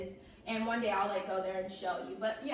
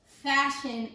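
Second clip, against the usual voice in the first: ordinary delivery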